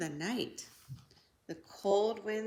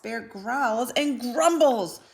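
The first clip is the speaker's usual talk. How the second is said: loud growling read; 3 semitones higher, 8 dB louder than usual. A narrator's reading. Angry bear.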